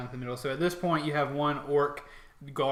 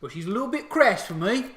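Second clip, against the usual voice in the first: raised, loud orc opinion; orc soldier; 8 semitones higher, 9 dB louder than usual. Acted. Orc judging the captain to his comrade, orc voice. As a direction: pass judgement as a fussy orc. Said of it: "the other one says".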